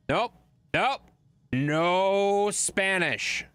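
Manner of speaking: long drawn-out, high and loud "no"; mock stern denial. Streamer shutting down chat's claims about Spanish, drawn-out mock verdict. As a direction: stretch it out slowly like a ruling.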